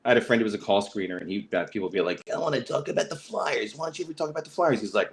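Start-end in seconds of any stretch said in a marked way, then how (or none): 2.3-4.8 high, pushy complaining caller, speeding up; whiny caller. Retold or imitated. Radio caller whining to the screener about the Flyers, high and pushy. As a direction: nag like a fan who won't let it go.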